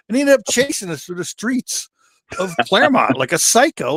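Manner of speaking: plain reading voice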